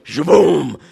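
loud booming shaboom; imitated explosion. Preacher mimicking fire lighting a campfire, explosive sound effect. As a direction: burst it out like fire hitting.

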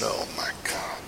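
breathless whispered gasp of shock. Retold or imitated. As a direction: whisper it, stunned. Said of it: "And I said".

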